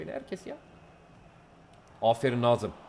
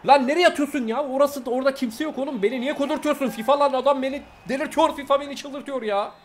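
much higher, louder angry outburst at the game; furious rant; 10 semitones higher, 4 dB louder than usual. Real, not acted. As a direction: lose it completely at the screen. Raging at bad passes in game.

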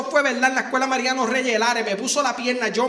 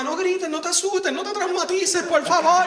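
higher, fretful pleading mother; anxious mom; 6 semitones higher than usual. Retold or imitated. Mom pleading with screaming kid, anxious high voice. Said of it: "Y la mamá".

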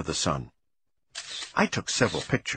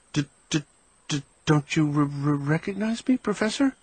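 nervous stammer; 5 semitones higher than usual. Acted. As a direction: stutter on the d and r in fright. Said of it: "I stammered".

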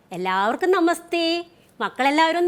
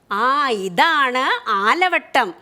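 slow, drawn-out teacher naming the object; slow clear naming; 5 semitones lower, much slower than usual. Shown as a demonstration. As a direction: say each syllable slowly so children can follow. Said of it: "ഒന്ന് പറഞ്ഞേ മക്കള്".

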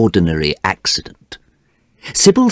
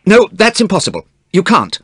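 high-pitched, alarmed protest; shouted refusal; 13 semitones higher, 3 dB louder than usual. A narrator's reading. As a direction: forbid it urgently. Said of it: "shouted Dorian".

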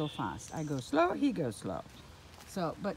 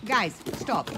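loud command to the dogs; loud stop; 4 semitones higher, 6 dB louder than usual. Real, not acted. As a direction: tell them firmly to stop. Dogs jumping on her.

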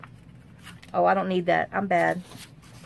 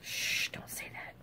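hushed unvoiced whisper; 14 dB quieter than usual. Real, not acted. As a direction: whisper it urgently. Hushing herself after jinx remark.

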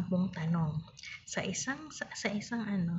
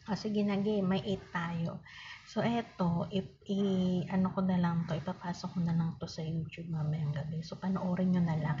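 ordinary talk, nothing marked

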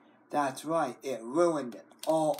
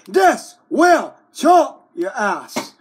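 loud slow threat; 6 semitones higher, 11 dB louder, slower than usual. Acted. Threatening with scissors.

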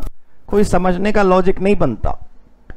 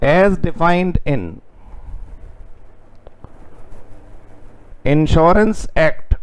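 slow dictation; 3 dB quieter, much slower than usual. Shown as a demonstration. Teacher dictating notes.

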